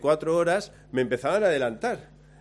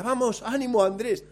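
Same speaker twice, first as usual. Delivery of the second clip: much higher, breezy call of encouragement; cheerful encouragement; 8 semitones higher than usual. Retold or imitated. A fresh friend passes Andrés and cheers him on, high and breezy. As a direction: pass him fresh and chipper.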